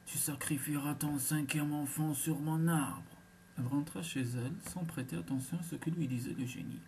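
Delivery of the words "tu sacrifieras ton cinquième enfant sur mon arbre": mild genie cry. A narrator's reading. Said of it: "le génie lui cria".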